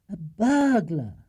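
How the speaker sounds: slow emphatic repeat. Acted. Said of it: "repeated Paddington".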